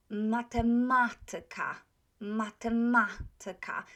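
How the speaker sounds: "matematyka" with "ma" before "tyka" loud and held; antepenultimate stress. Shown as a demonstration. Teacher showing accent third from last, stressed "ma". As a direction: punch the second "ma" in "matematyka". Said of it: "will be accented on the third".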